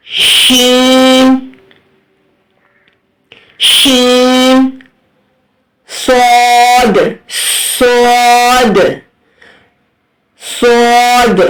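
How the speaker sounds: slow, stretched recitation of each letter. Shown as a demonstration. Teacher reciting letters for students to repeat, drawn out. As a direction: intone each letter long, like a qari.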